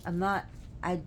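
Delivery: ordinary talk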